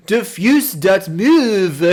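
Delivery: slow, drawn-out fake French; mock French accent. Streamer reading his misspelt file name in a light mock French voice. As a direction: say it like it's fancy French.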